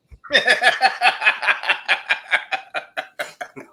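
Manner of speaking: long high laugh, loud then tapering; real laughter. Real, not acted. Friends after the confessional joke, laughing hard. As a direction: crack up and let it die down. Reaction to second joke's punchline.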